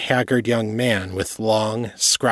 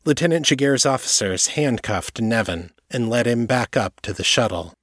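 plain reading voice

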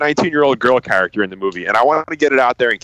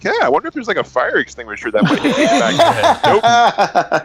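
high-pitched mock obliviousness; high, silly put-on voice; oblivious movie victim; 9 semitones higher than usual. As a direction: play a clueless character musing aloud, pitched way up. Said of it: "he never looks behind him like".

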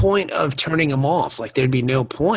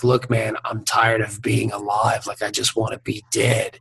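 weary villain; 5 semitones lower, 6 dB quieter than usual. Retold or imitated. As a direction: sigh it out like someone done with living. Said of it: "bad guy was like".

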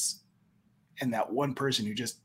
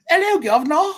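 much higher, louder mock British accent; fancy British accent; 13 semitones higher, 9 dB louder than usual. Host showing his bad British accent to Jackson, British accent. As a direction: ham up a posh British greeting. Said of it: "I did a fancy".

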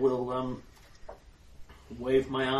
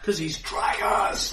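excited shout; 4 semitones higher, 6 dB louder, slower than usual. Real, not acted. Excited cry over character name.

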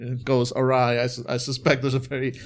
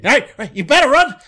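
higher, louder mocking yell; Captain Awesome yelling at the dogs; taunting shout; 9 semitones higher, 8 dB louder than usual. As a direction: taunt them loudly to run.

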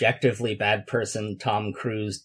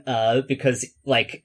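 ordinary talk

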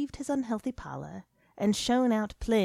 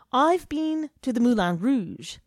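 boastful, louder; 6 semitones higher, 8 dB louder than usual. A narrator's reading.

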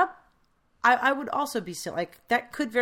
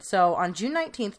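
usual speaking voice

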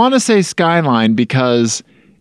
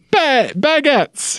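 squeaky baguettes; 14 semitones higher, slower than usual. Pretend. Hams up the flying baguettes.

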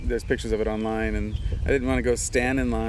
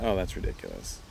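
dismissive aside; 4 semitones lower, 7 dB quieter than usual. Retold or imitated. Retold dismissal of his own thought.

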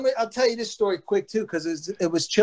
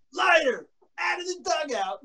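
commanding yell; 8 semitones higher, 3 dB quieter than usual. Retold or imitated. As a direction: shout the name, then command. Chili ordering him out.

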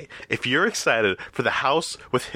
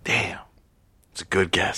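whisper; 6 semitones lower than usual. Real, not acted. Hushed reaction to guess.

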